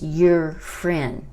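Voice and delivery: "your friend" drawn out slowly; slow model phrase. Shown as a demonstration. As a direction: say it slowly for learners. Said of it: "tu amiga".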